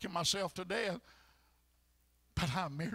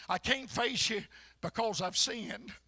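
usual speaking voice

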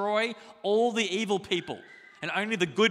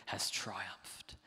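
whispered; 9 semitones lower, 10 dB quieter than usual. Acted. Elder's words read.

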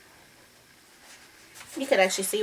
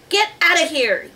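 loud, high command; yelling; 7 semitones higher, 6 dB louder than usual. A narrator's reading. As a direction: yell it away as Jack. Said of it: "Jack yelled at it".

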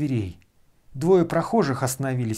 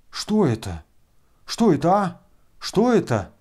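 breathless shock; 4 semitones higher, 3 dB louder than usual. A narrator's reading. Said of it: "задыхаясь".

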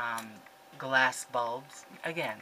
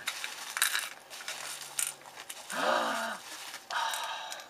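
감탄하며 길게 숨을 들이쉼; long delighted gasp; 5 semitones lower, 4 dB quieter than usual. Real, not acted. Opens a delightful card.

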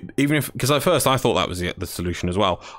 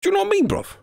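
mock London accent; much faster than usual. Mocking London slang "bruv.

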